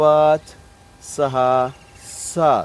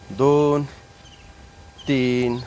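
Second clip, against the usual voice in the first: normal talking voice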